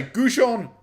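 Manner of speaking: goujon said "goo-zhon", stressed first; saying goujon. Shown as a demonstration. Streamer demonstrates the word goujon, "goo-zhon". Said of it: "I'm gonna say".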